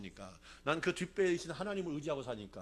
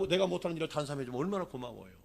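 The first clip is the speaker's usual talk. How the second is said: ordinary delivery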